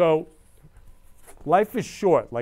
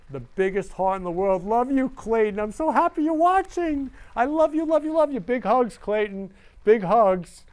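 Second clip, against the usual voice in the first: higher and much louder, gushing with joy; shower her with love at full volume; ecstatic gushing; 7 semitones higher, 6 dB louder than usual. Real, not acted. Thanking fan Clayton after the show, ecstatic and loud.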